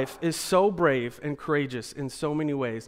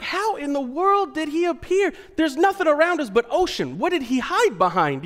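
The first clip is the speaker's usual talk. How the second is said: higher, baffled panic; high astonished; 9 semitones higher than usual. Retold or imitated. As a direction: puzzle over it in alarm. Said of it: "I'm like".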